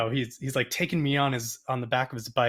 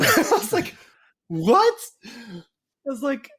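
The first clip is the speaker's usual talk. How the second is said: excited disbelief; 14 semitones higher, 4 dB louder than usual. Retold or imitated. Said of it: "I probably shouldn't speak in an accent".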